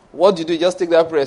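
normal talking voice

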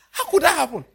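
high, loud mock disbelief; incredulous outcry. Preacher retelling his reaction to Elder John, incredulous outcry. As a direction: cry out in comic shock. Mock shock at friend's confession.

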